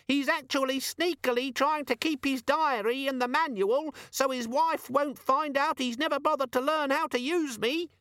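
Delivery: very high, tattling squeal; nasty squeaky demon. A narrator's reading. Demon revealing Vimes's secret diary, nasty squeak. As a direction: tell on him in a gleeful-spiteful squeak. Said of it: "said the demon nastily".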